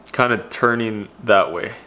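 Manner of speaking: speaking through covering hand; muffled behind palm; hand over mouth. Real, not acted. Seen 1: Hand covers mouth throughout the words; absent at -1.0 and +2.6.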